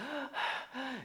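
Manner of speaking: mock labored breathing; imitated huffing and puffing. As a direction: puff heavily as if winded. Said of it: "they huffing and puffing".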